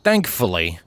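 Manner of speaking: usual speaking voice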